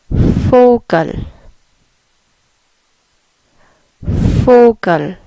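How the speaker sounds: Vogel with the V said as an F, falling; German Vogel. Shown as a demonstration. Teacher drilling Vogel for learners, careful German pronunciation. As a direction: say Vogel starting on a clear F sound. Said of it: "So it is Vogel".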